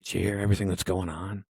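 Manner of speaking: hushed mock-paranoid whisper, then low voice; low, secretive, playing paranoid. Imagining a smoker of that strain, mock paranoid and hushed. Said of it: "sounds like a paranoia one".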